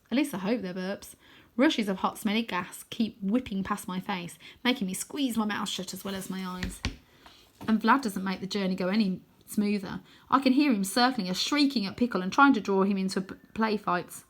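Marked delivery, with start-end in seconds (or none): none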